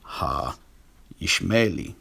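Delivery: "Ha-Ishmaeli" drawn out, ending in -AY-lee; Hebrew pronunciation. Shown as a demonstration. Lecturer shows the alternative reading, slow Hebrew pronunciation. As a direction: read "Ha-Ishmaeli" very slowly in Hebrew. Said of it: "you have the word".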